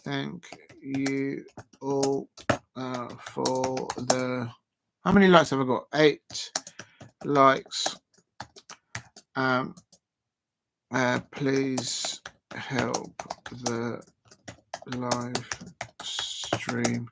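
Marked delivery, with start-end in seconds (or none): 0.0-4.5 slow drawn-out words while typing; typing aloud. Real, not acted. Streamer typing a chat message, slowly voicing it. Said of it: "So I'm gonna put in here". 11.3-17.1 very slow, softer word by word; typing aloud. Real, not acted. Streamer typing a message for viewers, slow and soft. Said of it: "So I'm gonna put in here".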